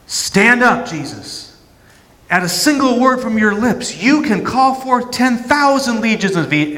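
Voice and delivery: high, loud, stirring call to arms; rally him like a triumphant champion; rousing hero voice. Acted. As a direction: heroic inner voice urging Jesus to take the throne. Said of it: "I can hear the hero voice".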